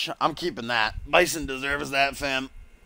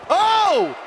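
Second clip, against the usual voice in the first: very high, loud "oh!"; real high-pitched scream of amazement; shocked shriek; 7 dB louder than usual. Real, not acted. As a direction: shriek in disbelief at what just happened. Reacts to absurd table finish.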